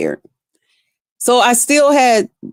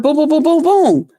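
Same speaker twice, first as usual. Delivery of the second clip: imitated pounding; 7 semitones higher than usual. Imitating knocks getting heavier.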